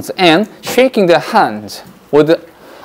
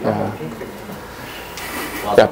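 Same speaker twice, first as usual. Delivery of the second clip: ordinary talk, nothing marked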